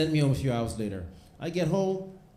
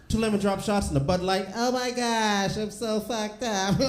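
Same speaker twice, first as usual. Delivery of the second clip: put-on drunk girl; 6 semitones higher than usual. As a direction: slur it out like a tipsy girl at the bar.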